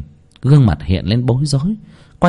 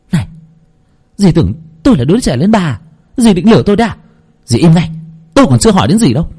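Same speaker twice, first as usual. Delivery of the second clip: higher, louder furious scolding; hissing in fury; 7 semitones higher, 3 dB louder, faster than usual. Acted. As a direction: spit the words at the lying aunt. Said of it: "rít lên". (rít lên giận dữ)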